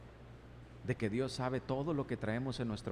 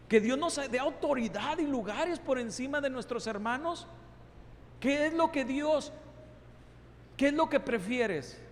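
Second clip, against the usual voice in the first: fiery preaching; 9 semitones higher than usual. Acted. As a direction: press the congregation hard. Preaching at full force.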